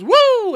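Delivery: loud, high siren-like "woo"; imitated cop's whoop. Cop stopping the drivers at their U-turn, sharp whoop. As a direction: whoop like a cop pulling someone over. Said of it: "the cop goes".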